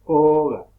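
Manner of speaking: Oga with a loud, long opening O; stressed O. Shown as a demonstration. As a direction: punch the first vowel of Oga. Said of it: "lleva acento la O".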